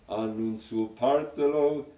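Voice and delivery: slow, with ghiacciolo drawn out; spoken line demo. Shown as a demonstration. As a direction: stretch ghiacciolo slowly. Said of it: "Is that it?".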